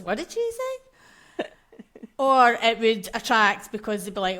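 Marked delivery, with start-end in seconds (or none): 0.0-1.4 put-on high, slow, baffled old man; father-in-law voice. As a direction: ask it totally lost, drawn out. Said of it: "my father-in-law included still goes".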